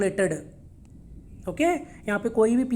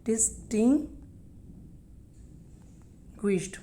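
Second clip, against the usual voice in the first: very slow distinguished with a long pause before GUISHED; syllable-by-syllable pronunciation; 7 dB quieter, much slower than usual. Shown as a demonstration. Teacher sounding out a word for learners, broken in parts. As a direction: say DIS-TING, wait, then a soft GUISHED in distinguished.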